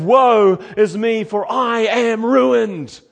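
slow, loud anguished cry of woe; Isaiah's lament. Acted. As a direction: cry out as one undone before God. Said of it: "Isaiah said".

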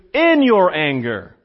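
"In your anger." slowly, leaning on "In" and falling; slow, weighted reading. Shown as a demonstration. Preacher presenting the better translation, slow and stressed. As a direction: lay stress on "In" and let it fall, slow. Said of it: "That's the best translation".

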